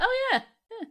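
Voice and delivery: high-pitched "just remembered" reaction; mock realisation. Podcaster acting out remembering the actor is Scottish, mock realisation.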